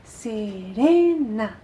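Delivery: "Serena" with RE stretched long and punched; stress on RE. Shown as a demonstration. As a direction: hit and hold the RE in "Serena". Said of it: "la fuerza la tenemos en re". ("Serena" con RE fuerte y muy alargada)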